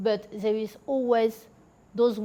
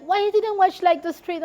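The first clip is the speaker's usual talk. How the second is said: retold driver yelling; 9 semitones higher, 4 dB louder than usual. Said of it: "he started to scream at me".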